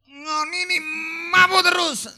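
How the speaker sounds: high, loud, rising to a yell; hammed-up wife scolding at full volume; angry wife shouting. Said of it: "Istrinya marah lagi". (istri berteriak marah pada suami mabuk)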